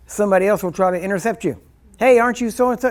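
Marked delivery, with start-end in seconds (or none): 2.0-2.9 eager stranger. Pretend. Stranger interrupts in store.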